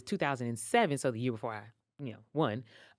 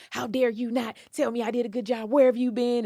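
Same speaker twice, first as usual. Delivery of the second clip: high, loud playful scolding; mock tongue lashing; 11 semitones higher, 9 dB louder than usual. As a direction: scold him, hammed-up. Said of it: "gave me a tongue lashing".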